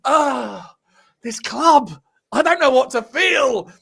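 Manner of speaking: high, loud, slow, anguished mock lament; a fan a few whiskeys in, wailing; hammed-up broken-hearted pundit after the game; Terry Flewers impression. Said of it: "I'm trying to work on his accent as well".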